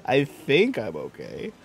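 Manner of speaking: slow, put-on uncertainty; hesitant mock reassurance, rising at the end; mock doubt. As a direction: draw it out, lean on think.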